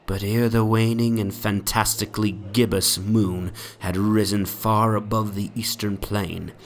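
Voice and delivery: ordinary delivery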